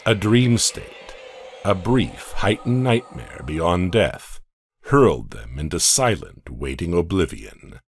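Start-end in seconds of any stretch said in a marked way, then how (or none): none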